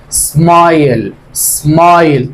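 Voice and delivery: سمايل said slowly twice, the long "aay" clearly held; slow teaching pronunciation. Shown as a demonstration. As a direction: repeat سمايل slowly for the class to copy. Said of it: "شوف عند الآي".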